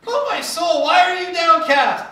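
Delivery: hammed-up David crying out to his soul; dramatic lament. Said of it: "he's like".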